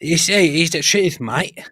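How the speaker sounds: loud, high mock British; British accent. Shown as a demonstration. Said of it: "British accent".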